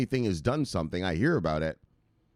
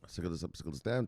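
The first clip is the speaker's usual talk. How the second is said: soft, low-key run-through; mumbled catchphrase; 4 semitones lower, 8 dB quieter, much faster than usual. Acted. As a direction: toss it off half under the breath. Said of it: "say it how we say it".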